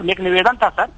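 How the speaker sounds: usual speaking voice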